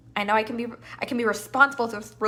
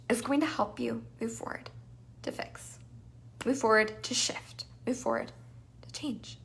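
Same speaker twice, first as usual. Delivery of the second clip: near-whispered, fading softer; 10 dB quieter than usual. Real, not acted. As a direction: lean in and whisper the steps. Softening list.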